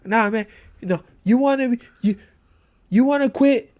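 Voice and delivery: put-on LA Cobra Kai accent, rapid in bursts; badly faked LA tough talk; Kevin Feige, mock LA accent. Co-host as studio boss refusing a resignation, mock LA accent. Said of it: "it's a L A Cobra Kai accent".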